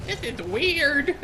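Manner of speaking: high, drawn-out squirm; slow, high-pitched imitation of someone weirded out; mock mortified reaction. Imitating mortified guests watching the show, high and slow.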